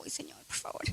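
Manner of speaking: breathy whispered plea, barely voiced; whispered prayer. Retelling her prayer to God, whispered. Retold prayer while father unreachable.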